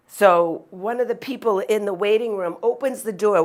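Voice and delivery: normal talking voice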